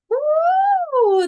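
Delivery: long, very high released woo; high loud whoop. Real, not acted. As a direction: blow off the intensity with a whoop. Shaken by what the reading triggers.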